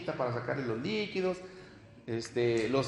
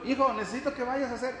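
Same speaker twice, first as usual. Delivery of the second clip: mother calling son; 7 semitones higher, faster than usual. Retold or imitated. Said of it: "Y luego".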